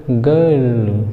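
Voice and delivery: normal talking voice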